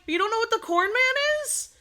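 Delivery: high, retold disbelieving question; incredulous. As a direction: act out shocked disbelief.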